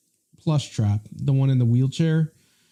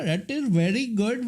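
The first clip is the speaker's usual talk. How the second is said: put-on Indian accent; 6 semitones higher than usual. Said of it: "I'm talking like a person from India".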